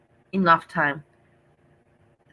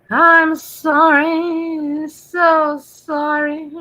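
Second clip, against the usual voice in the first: high, very slow and louder haunting cry; loud ghost wail; 9 semitones higher, 7 dB louder, much slower than usual. Pretend. Streamer hamming up a ghost for Halloween chat. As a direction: wail it out like a tormented spirit. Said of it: "now I'm being silly".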